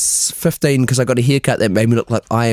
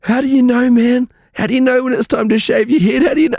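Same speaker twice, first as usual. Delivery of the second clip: imitating anxious balding guys; 10 semitones higher than usual. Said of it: "And they're like".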